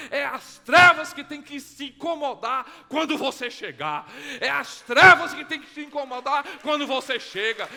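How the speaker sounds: rising, loud pulpit preaching that builds to shouts; full-force preaching. Acted.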